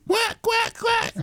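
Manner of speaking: very high-pitched mock quack; duck quacking. Host showing off to co-host, silly high duck quacks. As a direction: squawk three quick quacks like a duck. Mimics a duck after "look at me.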